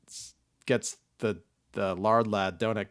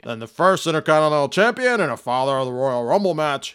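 Vince McMahon impression; 9 dB louder than usual. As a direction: boom it out like a wrestling boss. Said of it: "Vince McMahon tweeted".

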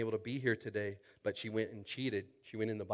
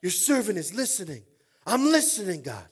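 high, very loud preacher's shout; full-force preaching; 18 dB louder than usual. Real, not acted. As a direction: build to a roaring altar call, then ease slightly.

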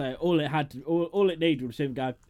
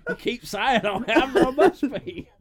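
high, loud, drawn-out laughing concession; mock resigned admission; 11 semitones higher, 9 dB louder, slower than usual. Playing the killer giving in to questioning, mock resigned. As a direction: give in slowly, high and whiny.

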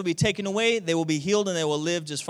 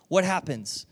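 ordinary delivery

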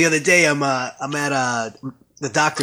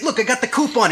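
higher-pitched Boston skit character; Boston accent; 7 semitones higher than usual. Acted. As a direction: imitate a Boston guy from the sketch. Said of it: "he's like".

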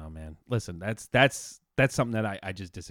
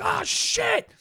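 retold yell. Driver nearly hit while wife listens, high retold yell. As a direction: squeal out a re-enacted alarm. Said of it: "I literally, I yelled".

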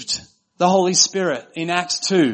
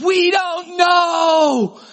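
very high, drawn-out wail; mock crying kids; 13 semitones higher, 4 dB louder, much slower than usual. Crying kids answering their mother in the car, mock wailing. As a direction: imitate whining children in a hammed-up wail. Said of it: "they were crying and they said".

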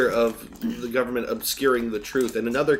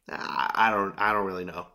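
low, halting mimicked admission; lower-pitched mock uncertainty; imitated hesitant dodge; 4 semitones lower than usual. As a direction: stumble and drop the voice as you mimic evasion. Imitates interviewee dodging a follow-up.

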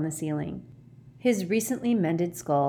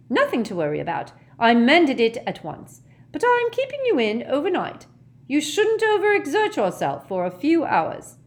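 high, loud, fussing nurse; brisk no-nonsense scolding, pitched high; bossy matron; 9 semitones higher, 6 dB louder than usual. A narrator's reading. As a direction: lay down the law to a patient. Matron fusses over patient.

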